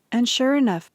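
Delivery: ordinary delivery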